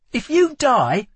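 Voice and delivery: high, loud snapped challenge; angry snap. A narrator's reading. Lila to the dying Kel, snapping. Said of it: "she snapped".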